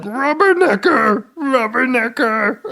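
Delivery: high, slow, hammed-up shriek of Rubbernecker; screaming rubbernecker. Johnny Depp shrieking at his ex-wife's boyfriend, high hammed-up yell. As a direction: shriek it up high and drawn out like a man losing it. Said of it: "just being like".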